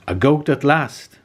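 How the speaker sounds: a troll's delighted slow cry; excited cry. A narrator's reading. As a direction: cry it out, thrilled to finally find a goat. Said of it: "he cried".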